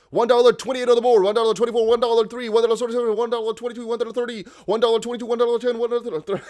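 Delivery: high, loud price chant; auctioneer. Pretend. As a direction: call the bids like an auction. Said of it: "did I just go work in an auction".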